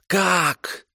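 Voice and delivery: high squealing shriek; shrieking kikimora. A narrator's reading. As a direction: squeal it out like a cornered water hag. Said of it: "взвизгнула Тамара".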